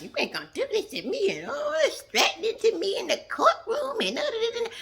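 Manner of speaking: high-pitched hammed-up whining imitation; exaggerated, swooping high mock protest; mock upset rant. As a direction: mimic her tantrum in a squeaky put-on voice. Mimicking upset Portia's courtroom rant.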